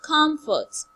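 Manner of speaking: "comfort" starting high and loud, falling low. Shown as a demonstration. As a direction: hit COM high, let fort drop. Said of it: "from high to low".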